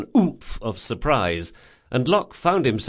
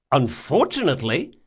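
first, more contained disbelief; incredulous echo; 3 semitones higher than usual. Acted. Intruder echoes the old man's word, incredulous. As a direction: question UNFORTUNATELY with a rising end.

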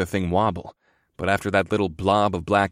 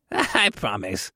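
put-on slow old man with a snicker; amused, drawn-out old quartermaster; much slower than usual. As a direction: chuckle into the words. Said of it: "the old quartermaster snickered".

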